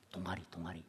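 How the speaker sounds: soft, dropped-away muttered repeat; quiet aside. Real, not acted. Pastor musing to congregation, soft aside.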